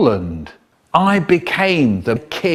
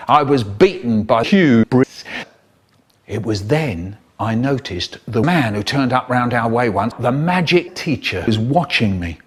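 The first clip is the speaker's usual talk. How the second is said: ordinary delivery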